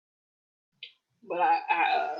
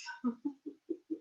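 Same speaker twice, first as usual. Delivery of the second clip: laugh; 7 semitones higher, 12 dB quieter than usual. Real, not acted. Laughing at old candles.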